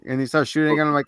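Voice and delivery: normal talking voice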